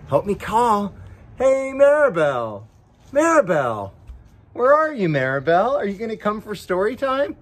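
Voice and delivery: high sing-song call to a hiding friend. Acted. Host calling the puppet Maribel to come out, sing-song. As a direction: call out brightly, drawing the name.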